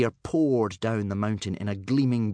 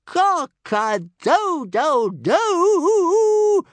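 very high, loud, slow rooster crow; little dragon crowing in triumph; triumphant crow; 8 dB louder, much slower than usual. Acted. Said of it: "crowed Toothless in triumph".

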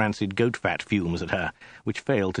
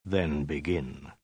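slow, low and much softer; quietly; 6 semitones lower, 6 dB quieter, much slower than usual. A narrator's reading. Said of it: "Khan said quietly".

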